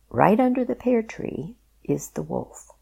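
plain reading voice

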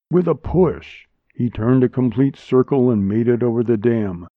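ordinary delivery

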